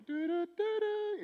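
high sung da-da-da of the 007 theme; mock spy-movie fanfare; humming spy theme. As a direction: hum the James Bond tune, high and playful. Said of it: "I was like".

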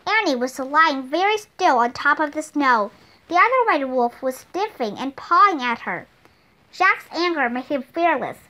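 ordinary delivery, nothing marked

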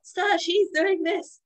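high, slow whiny telling-tales voice; high tattling mock whine; mocking tattletale. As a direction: mimic a player whining to the ref. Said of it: "when they're like".

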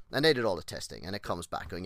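normal talking voice

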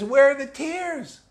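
high, slow, puzzled mock question; mock puzzled seeker. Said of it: "she says".

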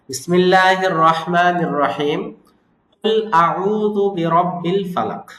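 slow, drawn-out tajweed chanting; measured Quranic recitation. Shown as a demonstration. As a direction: recite it slowly and melodically. Recites the surah.

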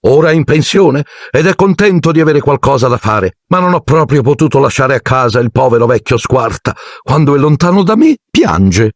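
high, put-on aunt voice; high fussy aunt voice; Aunt Marge. A narrator's reading. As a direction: talk up the poor old dog, pitched high. Marge talks about her dog.

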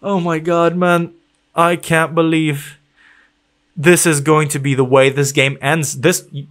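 usual speaking voice

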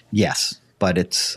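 usual speaking voice

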